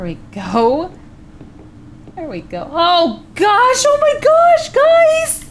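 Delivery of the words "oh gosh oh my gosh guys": high, loud shriek of alarm; shocked scream. Real, not acted. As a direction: scream as the fright hits. Scared by the attic.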